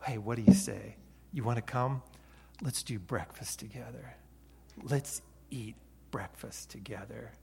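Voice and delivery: soft, fading to a whisper; a warm, intimate invitation face to face; Jesus speaking quietly and tenderly to his disciples; gentle personal invitation. Acted. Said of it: "hear Jesus himself peering into your eyes".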